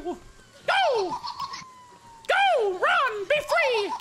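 high yelled go; mock heroic yell. Cheering on a fleeing friend, high yelled cry. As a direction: shout it like a sacrifice. Urging ally to flee in game.

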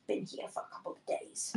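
hushed echo in a tried-out Aussie accent; under-the-breath practice of an Australian accent; shadowing Australian accent. Shown as a demonstration. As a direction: murmur it back, copying the Australian accent. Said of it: "I'm trying to shadow your".

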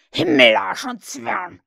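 low, slow, booming oath; thundering curse. A narrator's reading. Hook curses his men, slow thundering rage. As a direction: thunder it out, stretching each word. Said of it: "donnerte Hook".

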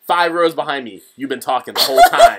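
high, loud mock telling-off; accusing goody-goody student. Host mimics student scolding the one five rows behind, loudly accusing.

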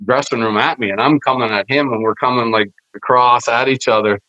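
usual speaking voice

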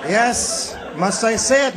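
usual speaking voice

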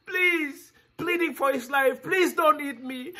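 high, desperate begging; crying plea. Acted. Boy begs the lion not to eat him, high and desperate. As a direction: plead high like a scared child.

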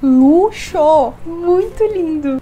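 gasping with excitement; thrilled. Real, not acted. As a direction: marvel at the luxury. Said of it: "eu tô chocada".

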